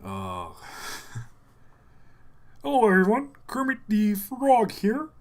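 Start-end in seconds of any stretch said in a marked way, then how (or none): 2.6-5.1 high puppet frog voice; Kermit. Acted. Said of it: "I'm trying to do Kermit real quick".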